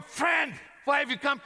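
loud, scornful sneer; mockery and scorn. Shown as a demonstration. Jesus voiced with scorn toward Judas. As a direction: spit it out mockingly. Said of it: "in a way of mockery and scorn".

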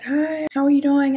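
a lightly voiced cheery greeting; cheery receptionist. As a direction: greet brightly like a front-desk lady. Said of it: "the lady is like".

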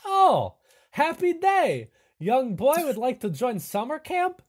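high, loud delighted hunchback; Igor overjoyed. Acted. Igor thrilled that Klaus may join camp, high and loud. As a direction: burst with joy at a new camper. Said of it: "Igor says".

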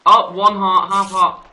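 loud, high panicky call; alarmed. Real, not acted. Gamer down to half a heart, loud alarm. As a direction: blurt it out in alarm. Nearly dead in game.

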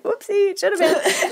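high-pitched, laughing mock innocence; playful oops. Author to producer after changing the words, playful oops. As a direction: play caught out, sheepishly cute.

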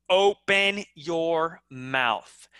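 slow, open-mouthed enunciation; slow, carefully articulated words; clarity. Shown as a demonstration. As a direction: open wide on each word. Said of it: "The last one is clarity".

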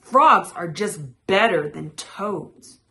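loud, insistent claim; crying out. A narrator's reading. Frog arguing with Toad, loud and insistent. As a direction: declare it loudly to the rival. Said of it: "Frog cried".

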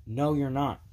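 normal talking voice

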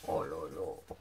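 deep, slow, drawn-out oh là là; low awed sigh. Real, not acted. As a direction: sink into a low amazed groan. Marvels at the pages. (oh là là grave et traînant)

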